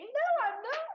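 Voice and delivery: high, loud, tearful protest; upset and protesting; weepy denial. Acted. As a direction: deny it while welling up. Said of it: "don't go all weepy".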